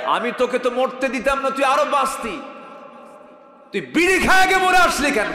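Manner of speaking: high reproach building to a shout; accuse, then explode on the question; acted divine rebuke. Preacher voicing God questioning a dead smoker, shouted rebuke. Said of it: "আল্লাহ যদি বলেন".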